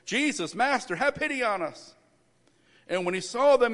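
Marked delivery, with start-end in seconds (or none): 0.0-2.0 high-pitched plea, only mildly raised; calling out. A narrator's reading. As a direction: read it as a restrained cry for pity. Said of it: "they called out in a loud voice".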